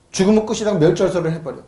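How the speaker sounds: ordinary talk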